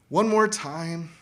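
normal talking voice

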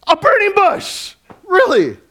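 high, loud, astonished outburst; incredulous Moses. Acted. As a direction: exclaim it in played-up disbelief. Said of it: "what Moses must have thought".

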